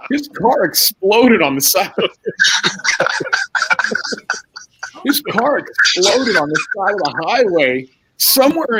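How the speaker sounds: words broken by laughter; can't get it out for laughing. Real, not acted. Retelling coach's news, cracking up mid-sentence.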